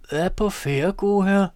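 lightly voiced old crone asking; old woman. A narrator's reading.

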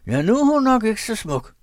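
high, loud mocking shout; husband shouting taunts about his wife. A narrator's reading. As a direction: bellow the taunt. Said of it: "råbte han".

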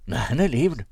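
ordinary delivery

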